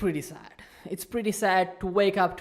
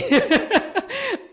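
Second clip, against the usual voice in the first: sudden high-pitched laugh; high laugh burst; 7 dB louder than usual. Real, not acted. Laughing at own awkward pivot.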